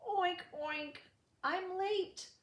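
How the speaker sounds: high squealy piggy voice; a little pig upset and late; high oinking pig voice; crying piglet. A narrator's reading. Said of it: "Poor Piggy wept".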